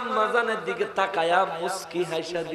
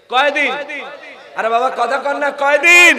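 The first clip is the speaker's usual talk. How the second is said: rising to a loud, high shouted question; loud prodding; 8 semitones higher, 11 dB louder than usual. Real, not acted. Preacher demands the audience say how many days, loud prodding. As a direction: press the crowd for an answer, louder at the end. Prodding a silent crowd to answer.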